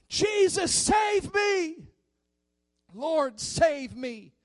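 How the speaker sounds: high, loud desperate cry, slowing at the end. Acted. Peter crying out to Jesus as he sinks, loud. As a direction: cry out like a sinking man. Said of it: "he cried out".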